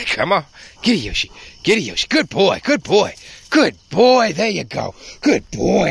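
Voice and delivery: high-pitched praise for a dog; real, hearty dog praise; encouraging praise. Real, not acted.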